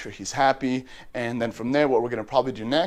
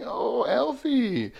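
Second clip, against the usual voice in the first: much higher, slow drawn-out coo; 11 semitones higher, much slower than usual. Real, not acted. Owner cooing the parrot's name, high and drawn out. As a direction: stretch the name lovingly.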